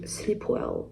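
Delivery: slow "sleep well" in an English accent; put-on English accent, unhurried. Shown as a demonstration. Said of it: "quand je fais l'accent anglais".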